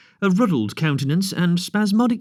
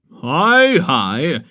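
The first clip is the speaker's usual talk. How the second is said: vigorous call; 2 semitones higher, 6 dB louder than usual. Acted. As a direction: call out sharply across the path. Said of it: "said a vigorous voice".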